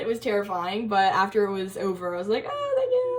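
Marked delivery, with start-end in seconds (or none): 2.5-3.1 exaggerated relief. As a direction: squeal it like a huge weight is gone. Acting relief after the talk.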